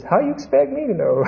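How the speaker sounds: high-pitched joke punchline; lightly hammed-up, high indignant reply; acted incredulous retort. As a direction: pitch it up as a mock-flustered comeback. Punchline of acted joke, frightened man.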